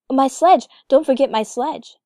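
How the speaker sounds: loud, urgent call; alarmed shout. A narrator's reading. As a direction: cry out for the sledge. Kay suddenly calls out.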